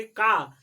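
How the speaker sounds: का said loud with a long full vowel; a strong, loud का; forceful long aa. Shown as a demonstration. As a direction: punch का out with power. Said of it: "उसको थोड़ा power से बोलोगे".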